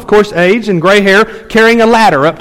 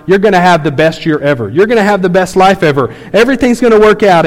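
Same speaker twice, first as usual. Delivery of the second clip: ordinary delivery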